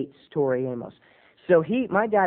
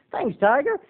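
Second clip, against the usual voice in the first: higher, bright mock dad; 9 semitones higher than usual. Dad thanking his son, higher mock voice. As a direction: toss it off like a cheery dad. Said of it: "He always calls me something like Tiger".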